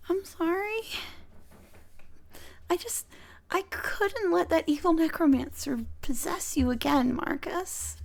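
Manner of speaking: high, halting apology; acted guilt, pitched high; upset apology. Gemma apologises, looking at floor.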